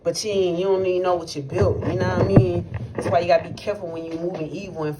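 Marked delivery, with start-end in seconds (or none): none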